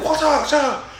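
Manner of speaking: high squeaky mock vampire voice; mock high squeal. Friend retelling a movie, squeaky mock monster line. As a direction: squeak it out like a silly creature.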